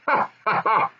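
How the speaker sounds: mock hearty goblin laugh; goblin father laughing. Goblin father laughing at the miners' coming trouble, mock laugh. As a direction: chuckle to himself over a secret. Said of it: "What are you laughing at".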